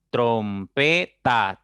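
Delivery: "trompeta" slow and loud, trom-pe-ta; split into syllables. Shown as a demonstration. Teacher shows three syllables, "trompeta" broken up slowly and firmly. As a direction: punch out each drawn-out syllable of "trompeta". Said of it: "tiene tres sílabas".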